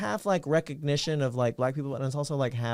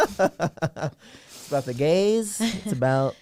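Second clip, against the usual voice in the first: slow, laughing through the words; 4 semitones higher, 4 dB louder, much slower than usual. Real, not acted. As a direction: laugh it out slowly.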